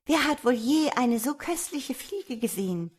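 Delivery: put-on high, admiring young woman's exclamation; entzückter Ausruf. A narrator's reading. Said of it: "rief das junge Weib und schlug in die Hände". (begeistert staunend)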